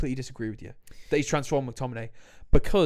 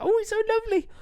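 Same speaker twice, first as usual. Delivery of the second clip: mocking gush; faster than usual. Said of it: "everyone's like".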